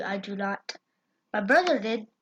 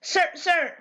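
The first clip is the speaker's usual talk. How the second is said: official calling out; 8 semitones higher, 5 dB louder than usual. Pretend. Playfully calling a player out.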